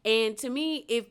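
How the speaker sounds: normal talking voice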